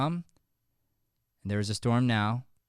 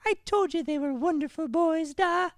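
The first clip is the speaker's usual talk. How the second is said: put-on very high girlish voice; daughter voice. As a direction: speak as the sweet daughter.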